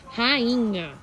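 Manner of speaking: normal talking voice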